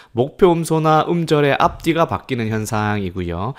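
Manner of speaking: normal talking voice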